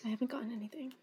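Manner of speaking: barely voiced whisper; hushed worry. Real, not acted. Still without a code, anxious whisper. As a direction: whisper it under your breath. No code yet, friend celebrating.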